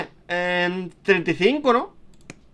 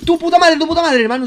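high, loud swearing out of shock; shocked cursing; 9 semitones higher, 6 dB louder, faster than usual. Real, not acted. Gamer swearing at the game after the scare, loud shocked shout. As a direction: swear it out, still rattled by the fright.

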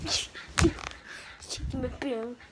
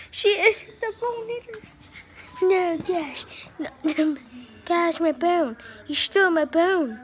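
higher, louder telling off the dog; upset protest; 5 semitones higher, 5 dB louder than usual. Real, not acted. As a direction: scold Dash for the bone. Dog stole the bone.

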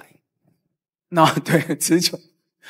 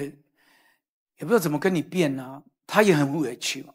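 ordinary talk, nothing marked

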